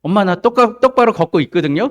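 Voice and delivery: mock protest to mother; young crab protesting. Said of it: "개 엄마가 그런 거예요".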